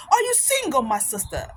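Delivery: put-on African-accented nosy question; African brother's accent. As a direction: ask it in a mimicked African accent. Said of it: "that's what he says".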